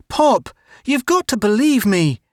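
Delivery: put-on high, urgent protest from a boy; pleading boy. A narrator's reading. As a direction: beg your father to believe you. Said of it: "Melvin protested".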